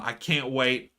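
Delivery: ordinary delivery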